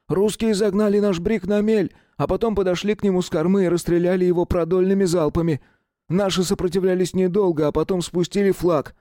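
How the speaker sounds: high, urgent soldier's report; young soldier voice. A narrator's reading. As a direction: rush the bad news out. (молодой солдат докладывает)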